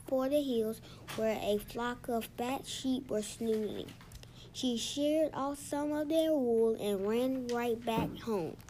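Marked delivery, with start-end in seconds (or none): none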